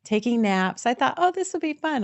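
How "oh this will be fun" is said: cheerful. Retold or imitated. Recalling her own cheerful thought.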